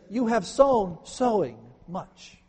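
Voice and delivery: sown stressed hard, then sowing much drawn out slowly; emphatic doubled verb. Shown as a demonstration. Preacher showing the Hebrew doubled verb, emphatic and slow. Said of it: "you say it twice. You say the verb twice".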